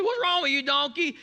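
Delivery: hammed-up fury at a stubborn animal; mock angry yell. Balaam yelling again.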